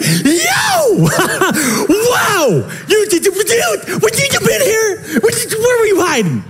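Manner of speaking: very high, yelled shock and disbelief; startled shouting room. Retold or imitated. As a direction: jump out of your skin with a laughing shout. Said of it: "the entire room was like".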